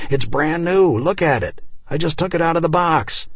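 urgent, rising protest, rushed at the end; insistent protest. Acted. Husband insists to wife the laptop is new, urgent. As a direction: plead your innocence, pressing hard.

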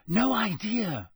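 usual speaking voice

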